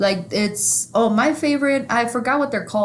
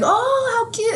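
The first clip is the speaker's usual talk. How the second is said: much higher, sweet lady voice; imitating a sweet old lady; 14 semitones higher, slower than usual. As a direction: lift it way up like a sweet older lady. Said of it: "she's like".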